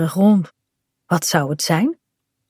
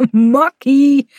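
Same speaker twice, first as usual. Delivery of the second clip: a laugh, then a high, loud boast; laughing hard; 6 semitones higher, 4 dB louder than usual. A narrator's reading. Kaat laughs at Bink's rolling challenge, boasting gleefully. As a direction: burst out laughing and crow it. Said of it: "Nu lacht Kaat nog harder".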